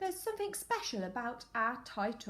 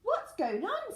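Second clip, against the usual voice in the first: sleepy mole; 10 semitones higher than usual. Acted. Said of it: "said the sleepy mole".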